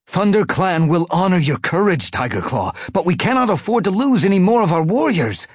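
high-pitched, urgent warrior voice; high, pressing appeal to a stubborn comrade; urgent plea. A narrator's reading. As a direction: plead for retreat over the noise of battle. Warrior urges retreat in battle.

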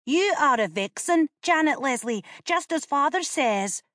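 put-on high boy voice, quick comeback; retorting boy. A narrator's reading. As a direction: kid brother firing back. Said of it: "retorted the boy".

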